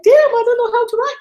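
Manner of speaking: very high, loud shocked realization; mock crushed disbelief, pitch shooting up; dismayed self. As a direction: play your own deflated shock, voice leaping up. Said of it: "I'm like".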